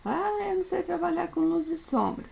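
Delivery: high whiny voice of a beginner student; mock student complaint. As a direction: play a pupil who says they can't do it, voice lifted high. Aluno imaginário reclamando. (imitando um aluno que reclama, voz bem mais aguda)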